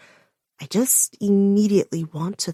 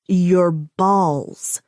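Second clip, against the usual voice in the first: each word crisply over-enunciated; "Your balls" enunciated hard; 3 dB louder than usual. A narrator's reading. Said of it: "I over-enunciate each word".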